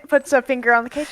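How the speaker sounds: usual speaking voice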